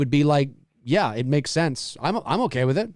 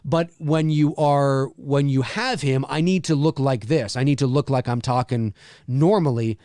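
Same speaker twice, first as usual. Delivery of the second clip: usual speaking voice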